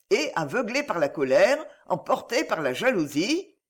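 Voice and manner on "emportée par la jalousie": ordinary delivery, nothing marked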